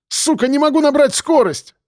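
very high, strained cursing; frantic shout. A narrator's reading. Driver unable to gain speed, frantic shout. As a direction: cry out in desperate frustration. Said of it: "прокричал он".

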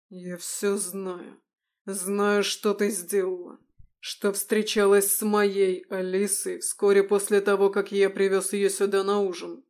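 loud, steely accusation; angry confrontation. Acted. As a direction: press her hard and loud. Son confronts lying mother.

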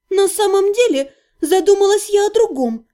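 usual speaking voice

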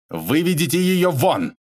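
drawn-out, high angry order; seething. A narrator's reading. Boss ordering the guard to remove the lady, seething. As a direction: order it slowly, boiling over. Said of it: "весь кипя пропыхтел Бобров".

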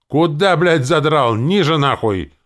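loud, high angry bellow; yelling. A narrator's reading. Zombie yelling at men hanging the flag too high. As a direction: yell furious orders at the workers. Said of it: "и заорал".

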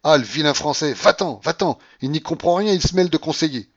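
high, loud scolding tirade; angry outcry. A narrator's reading. Babouchka to de Grier after losing, angry outcry. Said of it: "s'écria la babouchka".

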